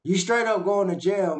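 ordinary talk, nothing marked

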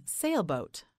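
ordinary talk

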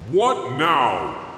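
slow, gruff north wind; a hint of a howling wind spirit. North wind annoyed at the boy's return, gruff and slow.

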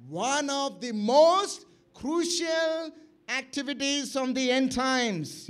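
slow, drawn-out, forceful preaching; weighty, deliberate pulpit delivery; emphatic preaching. Real, not acted. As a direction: preach it loudly, stretching the key words.

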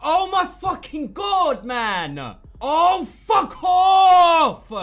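screaming with rage; furious drawn-out yell; berserk rage. Real, not acted. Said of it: "bullshit rage in the first game".

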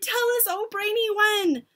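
high-pitched adoring plea; eager cry. A narrator's reading. Witches plead with their brainy leader, eagerly crying. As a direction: beg like an excited follower. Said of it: "they cried".

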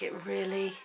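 ordinary talk

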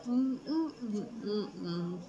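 humming while chewing food; muffled closed-mouth mm sounds; mouth full, chewing. Real, not acted. Seen 0: Food forked in at -1.0; closed, working jaw through the words.

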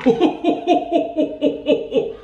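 high, breathy hammed-up ho ho laugh; mock laugh. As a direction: cackle it out in a high, silly voice.

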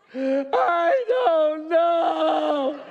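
put-on high, loud, drawn-out kid's whine; laughing whine of a kid who won't answer; whiny kid. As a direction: stretch it out like a stumped child. Son Liam's reply, acted.

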